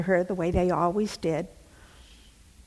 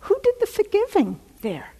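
high emphatic question; 13 semitones higher, 5 dB louder, faster than usual. Real, not acted. Rhetorical question to congregation.

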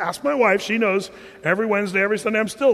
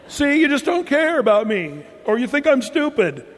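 mock hurt wife; 6 semitones higher, 4 dB louder than usual.

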